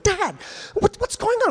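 put-on high alarmed child voice; imitating scared daughter. Dad voicing his daughter as troopers surround the car, high frightened voice. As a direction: sound like a frightened girl.

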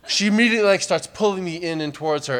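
ordinary delivery

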